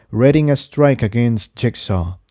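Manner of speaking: usual speaking voice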